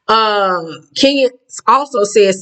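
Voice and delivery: usual speaking voice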